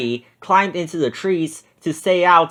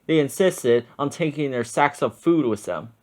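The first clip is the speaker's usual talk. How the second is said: ordinary delivery, nothing marked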